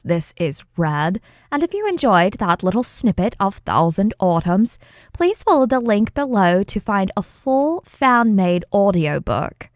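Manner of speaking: ordinary delivery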